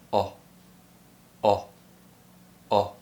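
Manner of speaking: "Oh" as a quick rounded British short o. Shown as a demonstration. Teacher drills the lip rounding, short separate "Oh" sounds. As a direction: lips round, tongue back, very short "Oh". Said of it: "round the lips".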